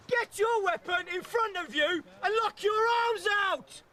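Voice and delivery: high, loud drill instructor; shouted order. Real, not acted. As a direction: yell the order at the squad. Instructor orders arms locked out.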